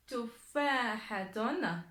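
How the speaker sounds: very slow, syllable by syllable; lecture appuyée. Shown as a demonstration. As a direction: stretch Tufahatun out for learners. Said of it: "Je vais lire les mots". (lecture lente, syllabe par syllabe)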